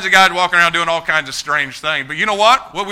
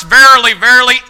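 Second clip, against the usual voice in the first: louder, stressed verily verily; emphatic reading; 7 semitones higher, 12 dB louder than usual. Shown as a demonstration. Preacher stresses the first verily to his flock. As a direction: hit both words hard. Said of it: "The first".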